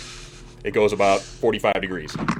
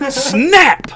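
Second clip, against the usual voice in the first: sudden loud, high SNAP; ungodly snap; 7 dB louder than usual. Retold or imitated. Doc yanks the arm into place, imitated ungodly snap. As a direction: burst out the word like bone cracking. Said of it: "there's this ungodly snap".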